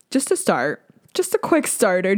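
usual speaking voice

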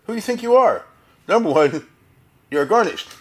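loud, high scolding rebuke; blasting rebuke. Retold or imitated. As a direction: tear into an upstart, loud and high. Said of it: "replied by blasting Meir".

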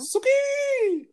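high sing-song call. Retold or imitated. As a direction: call the pig from afar. Calling the pig.

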